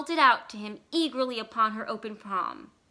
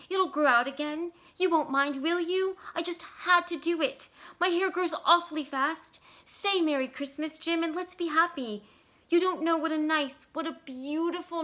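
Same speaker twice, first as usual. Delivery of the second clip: higher, eager pleading voice; lightly voiced pleading young wife; 6 semitones higher, 3 dB louder than usual. A narrator's reading. Della begging Jim not to look at her that way, high and eager. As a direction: coax him to be happy.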